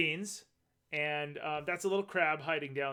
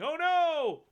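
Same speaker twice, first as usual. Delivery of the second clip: high, drawn-out cry, louder; mock alarm; 11 semitones higher, 6 dB louder than usual. Reader voices a creature running away, mock alarmed. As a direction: wail it like a cartoon escapee. Acting out character fleeing shark.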